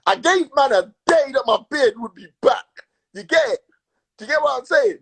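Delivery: ordinary talk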